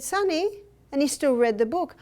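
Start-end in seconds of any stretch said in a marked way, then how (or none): 0.0-0.6 calling Sonny higher; raised call of the name Sonny; calling the child. Retold or imitated. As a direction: call out to get his attention. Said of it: "I said".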